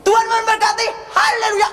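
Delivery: high-pitched mock preacher's blessing; Gilbert. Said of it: "langsung Gilbert gini".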